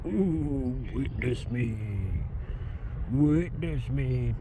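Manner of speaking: drawn-out, far-from-natural bird voice; lightly voiced bird, slowly intoned; cormorant's imagined voice. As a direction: speak for a bird with wings spread. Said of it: "It's like".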